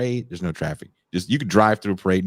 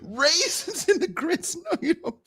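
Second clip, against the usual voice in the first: laughing disbelief; 3 dB quieter than usual. Real, not acted. Mocking Mac's raisin grits with a high laughing scoff. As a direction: scoff at the very idea.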